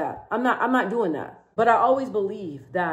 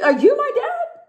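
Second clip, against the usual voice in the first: sharp high incredulous question, pitch shooting up; mock outrage; 4 dB louder than usual. To people telling her to soften up, squeaky indignant disbelief. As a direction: throw it back at them in disbelief. Snapping back at critics.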